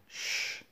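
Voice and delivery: long unvoiced shushing hiss. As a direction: shush everyone walking past, breathy and hushed. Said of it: "And he said".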